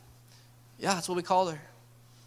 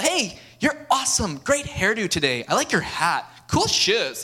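higher, bright mock-cheery praise; shallow compliments; 4 semitones higher, 4 dB louder, slower than usual. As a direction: toss off empty compliments, hammed-up. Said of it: "It's not just about".

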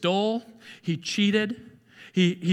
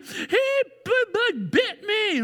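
put-on high, slow child wail; mock child whining to mom; whiny kid; 13 semitones higher, much slower than usual. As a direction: play the tattling kid, pitched way up. Child tells he was bitten.

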